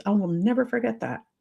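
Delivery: ordinary talk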